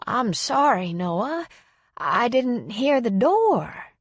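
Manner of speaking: put-on high, slow elderly lady's voice; befuddled elderly hostess apologizing; apologetic old woman. A narrator's reading. Dazed old woman greets her visitor.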